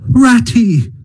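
high, bright call of a friend's name; excited shout across the field; joyful call. Acted. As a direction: call out with sudden joy. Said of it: "full of joy and excitement".

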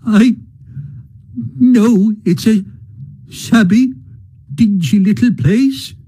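high, halting, sobbing words; broken sobbing. A narrator's reading. Mole sobs to Rat about his old home, broken. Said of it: "he sobbed forth at last, brokenly".

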